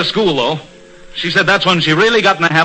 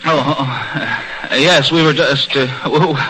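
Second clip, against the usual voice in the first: flustered laugh; 6 semitones lower, slower than usual. Acted. Boss catches them mid-fight.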